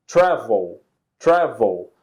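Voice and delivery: "Travel" with the first syllable strong, TRAV-el; first-syllable stress. Shown as a demonstration. Teacher shows the class where the verb's stress falls, first-syllable stress. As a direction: lean on TRAV in "Travel", let the ending fall. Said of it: "the strong syllable is the first, not the last".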